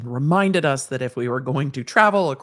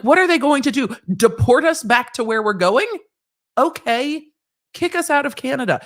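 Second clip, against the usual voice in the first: higher, louder, incredulous mock outrage; scoffing; 8 semitones higher, 4 dB louder than usual. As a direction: toss it off as absurd. Scoffing at husband's jail fear.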